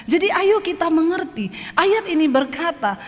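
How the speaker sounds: normal talking voice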